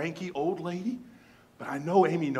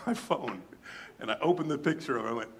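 normal talking voice

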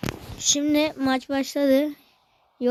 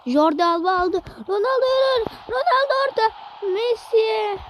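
excited commentator; 10 semitones higher than usual. Acted. Live football game commentary.

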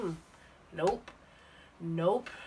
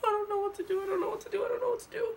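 high tearful whine; 13 semitones higher, faster than usual. Real, not acted. Crying out high while stuck in a mask. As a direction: whimper it like you're stuck.

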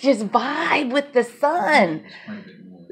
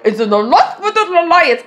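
mock English-accented Vader; 7 semitones higher, 3 dB louder than usual. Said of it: "you heard this English accent".

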